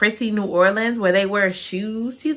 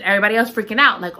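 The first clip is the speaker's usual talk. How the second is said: ordinary talk, nothing marked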